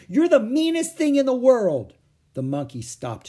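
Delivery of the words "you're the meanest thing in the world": loud, high-pitched angry outburst; shouting. A narrator's reading. Annie berates the monkey that threw fruit, shouting. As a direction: hurl the insult at full voice. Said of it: "Annie shouted".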